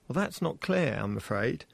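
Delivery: ordinary talk, nothing marked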